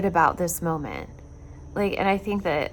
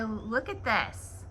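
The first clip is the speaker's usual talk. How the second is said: slow quiet look at this; 4 semitones higher, 5 dB quieter, much slower than usual. Real, not acted. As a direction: stretch "look at this" out slowly. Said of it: "look at my".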